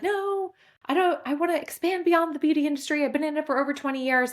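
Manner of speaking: high-pitched, insistent quoted protest. Retold or imitated. Woman recalls wanting out of the beauty industry, protesting. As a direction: start high on the no and push through. Acting out her past protest.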